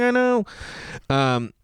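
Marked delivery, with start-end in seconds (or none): 0.0-0.4 high, chirpy agreement; exaggerated pleasant reply; mock small talk. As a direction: agree in a pitched-up neighborly voice.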